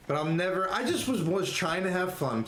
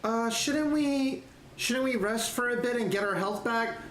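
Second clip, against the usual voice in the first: a nervous night elf who wants to rest; meek, pleading night elf; 6 semitones higher than usual. Acted. Timid night elf asks.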